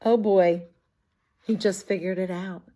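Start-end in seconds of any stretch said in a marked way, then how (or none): none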